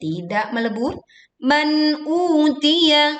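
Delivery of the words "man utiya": "Man utiya" chanted higher with a long u; slow recitation holding the u of "utiya"; lengthened vowel. Shown as a demonstration. Teacher showing two-count lengthening, long-vowel chant. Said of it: "panjangkan dua harokat".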